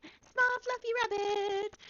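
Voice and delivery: very high, loud, slow squeal of joy; overjoyed gasp. Acted. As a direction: gasp and squeal like a delighted child. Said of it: "beyond happy".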